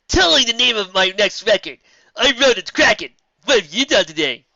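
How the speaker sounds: loud, high bragging; loud boast. Acted. Final brags about the record, loud. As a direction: show off triumphantly.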